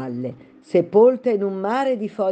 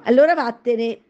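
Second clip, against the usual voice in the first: loud, higher command to go; ordering; 7 semitones higher, 5 dB louder than usual. A narrator's reading. Pigeon orders Alice away, loud sharp command. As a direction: drive her off. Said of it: "intimò il piccione".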